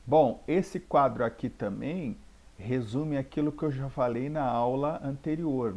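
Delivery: ordinary talk, nothing marked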